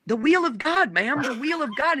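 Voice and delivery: high, slow Southern drawl on "wheel", said like "will"; dad's Southern accent. Retold or imitated. Son imitating his Southern preacher dad's accent. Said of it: "I know my dad's accent".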